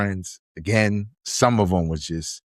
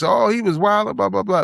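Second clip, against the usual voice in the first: mocking quote; 11 semitones higher than usual. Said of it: "anybody who's".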